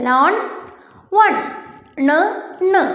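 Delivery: ലോൺ and വൺ, then the final ണ് sound alone; demonstrated rhyming endings. Shown as a demonstration. Teacher showing pupils how both words end, demonstrated N ending. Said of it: "അങ്ങനെയല്ലേ നിർത്തിയേക്കുന്നത്".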